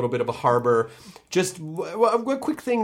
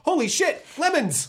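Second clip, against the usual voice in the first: high delighted surprise; surprised; 13 semitones higher than usual. Retold or imitated. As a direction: burst out in amazed joy. Sudden delighted discovery.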